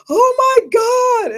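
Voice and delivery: high, slow, tearful cry; horrified wail. Retold or imitated. Recruit realizes her hair is going gray, high slow wail. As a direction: wail it out like the world just ended.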